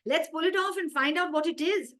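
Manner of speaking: put-on boy wants to free the goat; eager boy. Haris proposes pulling off the disc, eager.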